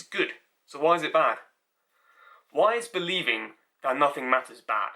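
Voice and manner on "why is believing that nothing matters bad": ordinary talk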